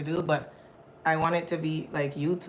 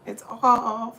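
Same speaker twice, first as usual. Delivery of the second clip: loud, exasperated statement; 6 semitones higher, 7 dB louder than usual. Real, not acted. Streamer fed up with phone, loud and exasperated. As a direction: call out that it's off, fed up.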